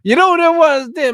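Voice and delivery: excited exclamation. Real, not acted. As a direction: shout the question out in delight.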